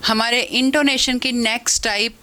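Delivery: ordinary delivery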